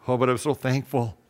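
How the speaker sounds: usual speaking voice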